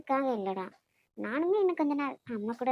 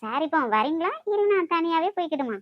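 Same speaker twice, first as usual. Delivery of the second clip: playful huff; 5 semitones higher, 6 dB louder than usual. Acted. Playful mock-huffy threat to leave.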